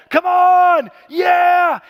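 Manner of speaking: high, loud cheering yell; excited shouting. Acted. Young David on the battlefield shouting like a football fan, excited yell. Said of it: "he starts shouting".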